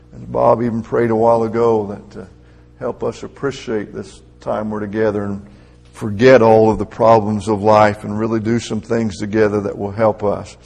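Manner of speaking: ordinary delivery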